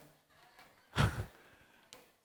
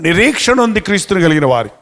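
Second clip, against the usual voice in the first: ordinary delivery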